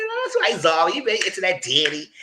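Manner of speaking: high squeaky teasing voice; mock teasing. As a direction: needle her in a sing-song squeak. Mocking friend's crush, high.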